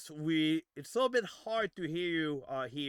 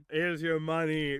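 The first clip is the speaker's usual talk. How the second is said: ordinary delivery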